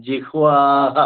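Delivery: ജിഹ്വാഹ with a long, drawn-out "aa"; long vowel. Shown as a demonstration. Teacher showing the mantra, long vowel in ജിഹ്വാഹ. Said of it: "ദീർഘമാണ് ജിഹ്വ".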